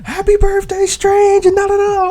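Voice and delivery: squeaky high cartoon villager chirp. Villager neighbor cheerfully greets the birthday player, squeaky high voice. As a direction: pitch way up like a tiny animal neighbor. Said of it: "my other O G neighbors ... was like".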